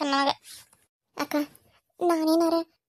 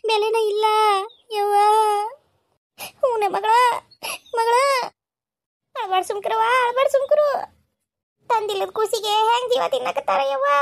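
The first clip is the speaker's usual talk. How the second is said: high, crying begging; sobbing plea; 6 semitones higher than usual. Acted. Daughter begs her mother to take her home, sobbing high. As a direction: plead through tears.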